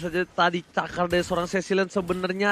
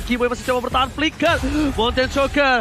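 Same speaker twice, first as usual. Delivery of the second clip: rising, higher hype call; esports caster; 5 semitones higher, 3 dB louder than usual. Acted. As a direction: build the call up fight by fight. (komentator esports makin memanas)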